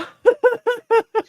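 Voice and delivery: high-pitched burst of laughter. Real, not acted. Cracking up at his own bishop jab, high laugh. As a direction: squeal a laugh at the joke.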